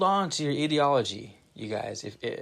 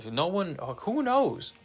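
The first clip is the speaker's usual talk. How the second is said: mocking high whine; 6 semitones higher, slower than usual. Mocking a dumb reply.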